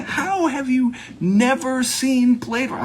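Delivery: high, drawn-out mock astonishment; aghast disbelief. Acts out aghast movie fan.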